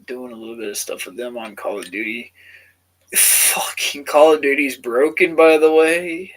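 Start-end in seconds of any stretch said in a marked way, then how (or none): 3.1-6.3 loud, slow exasperated rant; angry rant. Real, not acted. Gamer vlogger ranting to viewers about broken Call of Duty, loud and angry. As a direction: slam the game, loud and slow.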